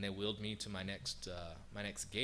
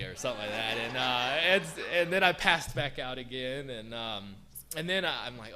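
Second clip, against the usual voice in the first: normal talking voice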